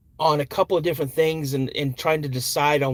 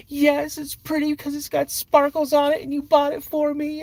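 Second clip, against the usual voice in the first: put-on high, bright child's voice; little girl voice; 13 semitones higher than usual. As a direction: answer like a proud second-grader. Daughter answering happily.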